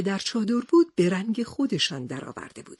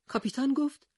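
ordinary delivery

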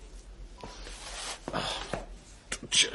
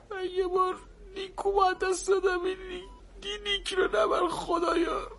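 higher, sobbing plea to God; weeping plea, higher pitched; crying prayer; 6 semitones higher, 4 dB quieter than usual. Acted. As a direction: break down begging God. Grieving prayer over dead child.

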